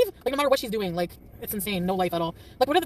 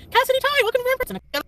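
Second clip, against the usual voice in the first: squeaky, much higher happy hello; excited high greeting; 6 dB louder than usual. Real, not acted. Streamer greeting a chat viewer, high and excited. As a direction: greet them like a delighted friend.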